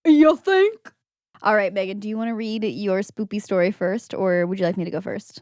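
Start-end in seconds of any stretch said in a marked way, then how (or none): 0.0-0.9 high, loud rising catchphrase voice. Acted. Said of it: "I should have looked up [name] phrases".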